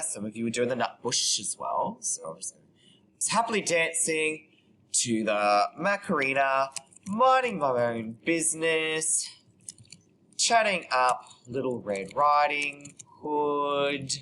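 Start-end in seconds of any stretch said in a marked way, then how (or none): 3.1-14.1 slow, stretched dictation; composing aloud. Real, not acted. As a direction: draw the words out as you type them. Composing aloud while typing.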